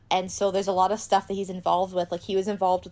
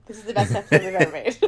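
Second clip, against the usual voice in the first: laughing; 6 dB louder, faster than usual. Real, not acted. Delighted at the fan fiction idea.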